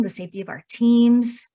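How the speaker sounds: ordinary delivery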